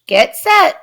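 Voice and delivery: loud coach starting a race; bellowing coach. A narrator's reading. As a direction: call the start across the pool.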